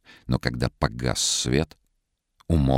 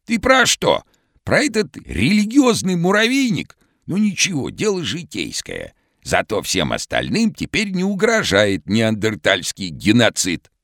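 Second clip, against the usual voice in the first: hoarse, very high cartoon shout; vintage cartoon voice; 11 semitones higher, 4 dB louder than usual. A narrator's reading. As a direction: call out like an old cartoon character. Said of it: "хриплым, как в старом мультике".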